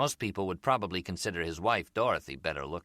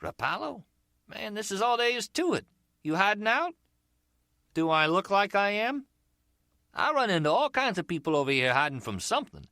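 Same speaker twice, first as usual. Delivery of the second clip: much higher, louder, probing street hustler; umbrella seller; 9 semitones higher, 3 dB louder than usual. Acted. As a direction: lean in, curious but casual.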